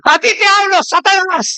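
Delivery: grito agudo de exorcismo; pastor exorcista imitado. Retold or imitated. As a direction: exige al demonio que salga, gritando. Pastor gritando a Satanás. (imitando al pastor que grita)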